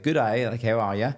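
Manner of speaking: put-on a breezy Australian greeting, fully committed to the accent. Auditioning actor greets the security guard in an Australian accent. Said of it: "Like, really, like, committed".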